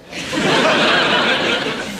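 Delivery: bursts into high, loud laughter; a big, real guffaw. Real, not acted. Wife's jibe about the club cat.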